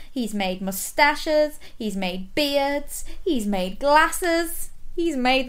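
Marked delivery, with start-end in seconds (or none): none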